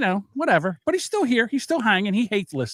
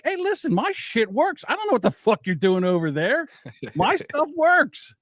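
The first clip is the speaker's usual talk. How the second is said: defensive retort; 7 semitones higher than usual. Retold or imitated. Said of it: "I'm like".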